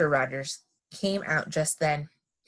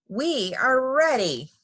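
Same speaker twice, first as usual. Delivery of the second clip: slow, high sing-out call; 7 semitones higher, 3 dB louder, slower than usual. A narrator's reading. Amelia Bedelia announces they are ready, slow high call. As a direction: stretch it out as a call. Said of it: "called Amelia Bedelia".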